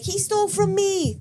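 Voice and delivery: high, drawn-out mock outcry; imitated false accusation. Scammer accusing a tourist, imitated high slow outcry. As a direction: cry thief in a hammed-up high voice.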